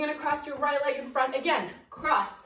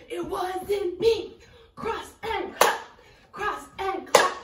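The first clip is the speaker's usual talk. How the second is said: shouted lyric; 6 semitones higher, 4 dB louder, slower than usual. Acted. Chanting the song line mid-routine.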